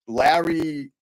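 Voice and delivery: usual speaking voice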